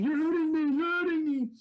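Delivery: high, loud desperate wail; retold panicked cry. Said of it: "I'm bawling".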